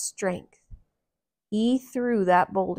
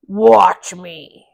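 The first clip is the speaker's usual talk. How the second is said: giant voice; 14 dB louder than usual. Acted. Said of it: "said the giant".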